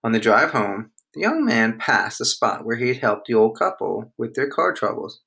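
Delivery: usual speaking voice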